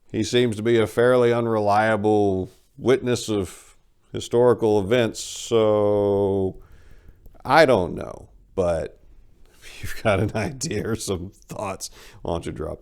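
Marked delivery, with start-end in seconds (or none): none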